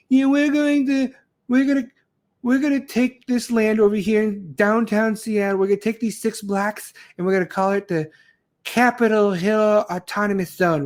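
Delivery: lampoon the protesters with a silly high voice; mock protester. Mocking CHAZ protesters.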